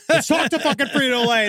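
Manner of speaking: high, emphatic rallying cry; mock fired-up. Host jokingly rallying listeners to investigate coworkers, mock fired-up. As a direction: pitch it up like a motivational rant. Repeats suggestion in mock enthusiasm.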